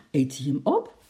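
sharp gasp, then a startled question; startled, gasping disbelief; shocked. A narrator's reading. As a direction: ask it aghast after an audible intake of breath. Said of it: "vroeg Lotje geschrokken".

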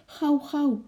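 high, alarmed urging; startled call. A narrator's reading. Lotje hurrying her father along, startled call. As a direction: urge someone on in sudden fright. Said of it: "riep Lotje geschrokken".

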